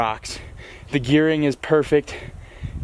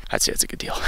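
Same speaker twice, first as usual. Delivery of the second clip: whispered aside; 6 dB quieter, much faster than usual. Real, not acted. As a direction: whisper it like a secret. Aside after bike price.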